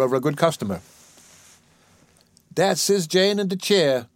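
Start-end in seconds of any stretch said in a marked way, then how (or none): 2.5-4.1 higher, slow animated caretaker voice. Acted. Caretaker showing a visitor the patient, animated. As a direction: point out the patient with animation, drawn out. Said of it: "said the animated one".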